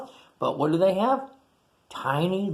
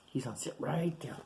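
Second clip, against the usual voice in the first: plain reading voice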